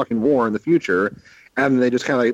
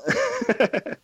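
high giggle; 5 dB quieter than usual. Real, not acted. Laughing at impression.

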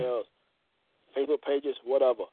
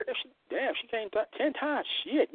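awed hush; 8 semitones higher, 5 dB quieter than usual. Pretend. Awed at the count.

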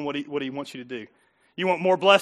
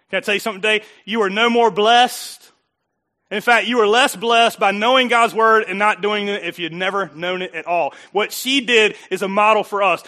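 loud, insistent sermon voice; forceful preaching; 3 semitones higher, 8 dB louder than usual. Real, not acted. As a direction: press the point hard on the congregation.

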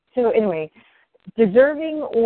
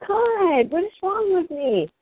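self-reproach; 10 semitones higher than usual. Retold or imitated. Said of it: "I would go back and go".

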